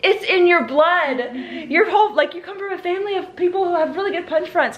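coach voice. Retold or imitated. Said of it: "Michael was like".

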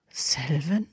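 soft, low whisper; hushed, questioning undertone. A narrator's reading. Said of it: "flüsterte Draco Harry zu".

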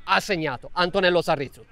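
usual speaking voice

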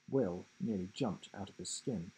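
plain reading voice

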